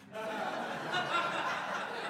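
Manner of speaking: a high amused laugh at himself. Real, not acted. Preacher laughs at his own vanity, amused high laugh.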